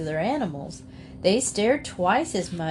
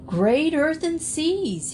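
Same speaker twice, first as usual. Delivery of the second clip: high, slow astonished cry; startled exclamation; 8 semitones higher, slower than usual. A narrator's reading. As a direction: gasp it out in amazement. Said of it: "he exclaimed".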